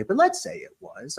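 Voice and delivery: ordinary delivery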